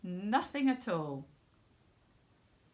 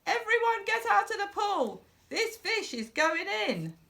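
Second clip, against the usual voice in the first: high, loud shout; yelled; 12 semitones higher, 5 dB louder than usual. A narrator's reading. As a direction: clear the pool with a big shout. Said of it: "The fireman yelled".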